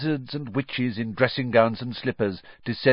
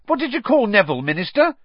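high, eager and louder question; excited; 13 semitones higher, 6 dB louder, faster than usual. Acted. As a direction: burst out with the question, thrilled. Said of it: "he said excitedly".

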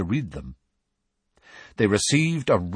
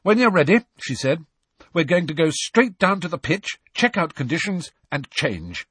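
brisk captain; 8 semitones higher than usual. A narrator's reading. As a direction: take charge briskly. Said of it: "Angelina brisk team captain".

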